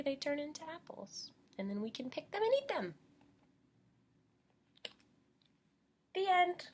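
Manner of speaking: put-on lively storybook reading for little kids; bright teacher storytime voice; reading to children. A narrator's reading. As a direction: read it like storytime for preschoolers. Teacher reads picture book to class.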